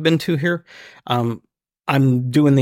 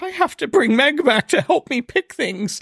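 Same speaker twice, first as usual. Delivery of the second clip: high-pitched comic panic; overwhelmed; 12 semitones higher than usual. Retold or imitated. Shopper overwhelmed by the booze selection, squealing. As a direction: squeal it as if swamped by choice. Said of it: "It was so overwhelming".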